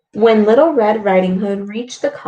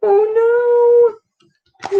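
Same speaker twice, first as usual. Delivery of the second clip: dismayed oh no; 13 semitones higher, 5 dB louder than usual. Real, not acted. Reader's dismay for children.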